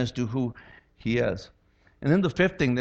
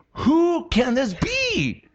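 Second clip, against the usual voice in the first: high, slow amazed question; awed wonder; 7 semitones higher, much slower than usual. Retold or imitated. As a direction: sailors stunned by the calm, awed.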